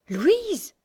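high-pitched startled cry of a name; astonished question. Acted. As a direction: react in surprise, voice leaping up. Astonished at hearing her sister named. (stupéfaite, voix qui monte)

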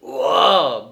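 tipsy sacristan bellowing again; drunken bellow. Acted. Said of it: "beugla de nouveau Ephrem".

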